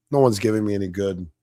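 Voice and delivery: ordinary talk, nothing marked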